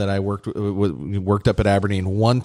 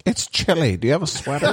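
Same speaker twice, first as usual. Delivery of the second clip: put-on sweet old lady voice; 6 semitones higher than usual.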